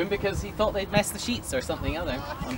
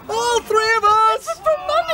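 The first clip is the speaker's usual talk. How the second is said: squeaky protesting sailors; 13 semitones higher, 5 dB louder than usual. Acted. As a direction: protest in silly high voices.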